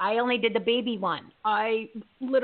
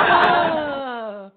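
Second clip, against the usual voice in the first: laughing; 5 semitones higher, 7 dB louder than usual. Real, not acted. Big laugh after realization.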